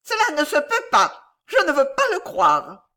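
high, loud, tart lady's denial; shrill, sour voice. A narrator's reading. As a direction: refuse to believe it, shrilly. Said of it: "elle dit d'une voix aigrelette". (voix aigrelette et perçante)

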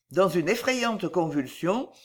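ordinary delivery

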